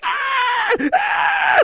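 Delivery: high, shrill mock screams of a frightened little girl; girl's panicked scream. Retelling the security video, a terrified little girl screaming. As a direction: shriek like a kid grabbed by a raccoon. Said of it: "She's like".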